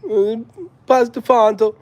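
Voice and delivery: high Jamaican patois, playful; mock Jamaican accent. Vlogger imagining himself in Jamaica, mock Jamaican accent. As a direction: ask in a put-on Jamaican accent. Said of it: "Go to Jamaica, be like".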